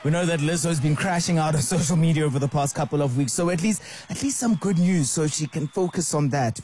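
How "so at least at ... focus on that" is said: ordinary delivery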